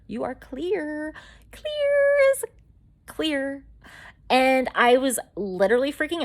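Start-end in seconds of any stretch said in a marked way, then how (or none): 0.1-3.6 high, held singsong notes on "clear"; drawn-out all-clear announcement. Retold or imitated. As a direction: stretch it out high, like a proclamation. Retold doctor announcing all-clear.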